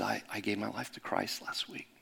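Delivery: hushed, mildly emotional retold confession; soft, halting, understated tearfulness; tearful confession, retold. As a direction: quietly imitate a man confessing through tears. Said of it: "he starts to cry".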